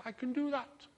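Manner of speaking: high little fish voice. Acted. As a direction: make it a small magical fish piping up. Fish grants a wish.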